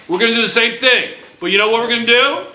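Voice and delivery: rally the class like a party is coming; excited announcement. Real, not acted. Teacher announces a lab, cheering.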